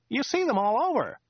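high, chatty local driver voice; chatty driver Joe. Acted. Driver Joe chatting to Dirk on the drive, high chatty voice. Joe's voice.

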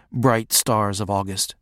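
ordinary delivery

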